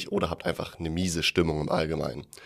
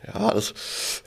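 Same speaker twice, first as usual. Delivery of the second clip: slow, strained, mouth on fire; mouth burning from spicy food; 13 semitones higher than usual. Acted. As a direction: push the words past the heat. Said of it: "Oh, ist das scharf".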